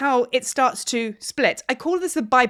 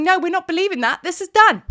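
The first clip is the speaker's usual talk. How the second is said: higher, louder, fast and firm; a higher self slamming the door on an old belief; 6 semitones higher, 5 dB louder than usual. Retold or imitated. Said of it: "your higher self is going".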